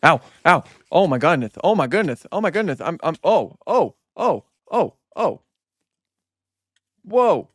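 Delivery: loud, high panicked yelps and gasps. Real, not acted. Gamer attacked by mobs, real panicked yelps. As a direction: yelp in real fright as something hurts you.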